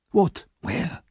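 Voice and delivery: sudden high startled cry. A narrator's reading. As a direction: jump at it, alarmed.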